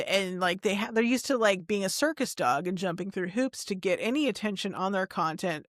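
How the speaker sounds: ordinary talk, nothing marked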